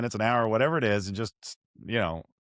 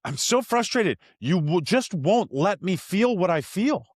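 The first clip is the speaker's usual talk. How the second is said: put-on high, slower voice of an upset kid; frustrated son; 8 semitones higher than usual. Son tells dad he just wants to feel, high and slow. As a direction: let a small boy's frustration out.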